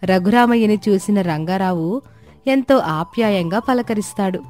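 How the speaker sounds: plain reading voice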